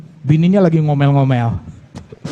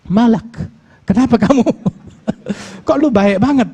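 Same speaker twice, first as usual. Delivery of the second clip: high, laughing questions; laughing disbelief; 7 semitones higher than usual. Acted. Husband laughing in disbelief at his kind wife.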